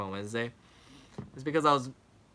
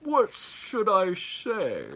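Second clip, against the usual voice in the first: put-on Sean Connery accent; 6 semitones higher, much slower than usual. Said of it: "I was gonna do a Sean Connery accent".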